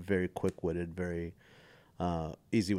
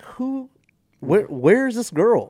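much higher, hammed-up searching; mock confusion; 13 semitones higher, 11 dB louder, slower than usual. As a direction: pretend to look around for her, high and loud. Mock searching for song girl.

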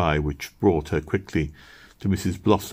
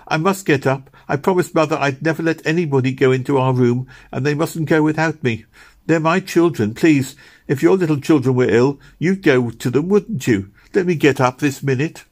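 high, louder earnest begging; urgent pleading; 8 semitones higher, 4 dB louder than usual. A narrator's reading. Meg pleads to go to her children, urgent pleading. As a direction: press her case, begging hard. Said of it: "pleaded Meg urgently".

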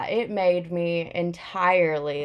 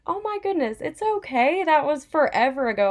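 much higher, taken aback then soothing; surprised reassurance; 8 semitones higher than usual. Retold or imitated. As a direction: brush off an old hurt, high and startled. Reassuring an apologizing old bully.

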